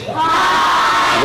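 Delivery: breathy high puppet hi; a high voiceless little shout; puppet whisper-shout. Acted. Dummy answers the officer again, high whispered puppet shout. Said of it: "Now, I know you heard that".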